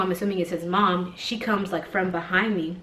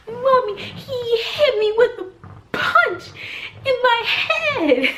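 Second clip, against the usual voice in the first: high, loud, slow mock child's cry; wailing child; 5 dB louder, much slower than usual. Son tattling to mommy about being hit, wailing. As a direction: draw it out like a tearful kid. Retold child crying to his mom.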